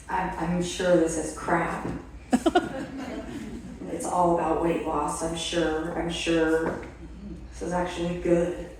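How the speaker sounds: low sarcastic mumble; sarcastic mutter. Retold or imitated. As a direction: grumble at the box in mock doubt. Grudging mock sarcasm to box.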